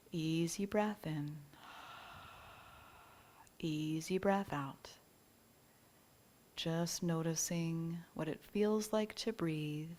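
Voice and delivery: calm meditation guide, short phrases with long pauses; unhurried yoga nidra guidance, leaving space for each breath; guided relaxation. Real, not acted. Teacher easing listeners into yoga nidra rest, calm guided relaxation.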